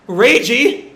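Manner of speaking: loud call through a door. Acted. Grandma calling to Reiji through the door, loud. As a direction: call his name urgently.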